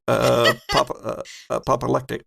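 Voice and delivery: false starts on "apoplectic", broken by "uh"; stumbling pronunciation. Real, not acted. Game master failing to say "apoplectic", stumbling pronunciation. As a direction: trip over "apoplectic", trying "pop-" first. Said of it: "I can't say the word".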